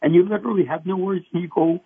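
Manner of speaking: ordinary talk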